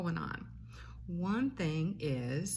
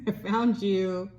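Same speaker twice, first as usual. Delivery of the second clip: playful peekaboo; 4 semitones higher, 8 dB louder, slower than usual. Acted. Peekaboo reveal.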